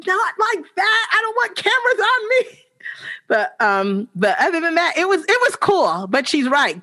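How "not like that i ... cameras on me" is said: playful refusal. Retold or imitated. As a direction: protest with a laugh. Refusing friend's praise.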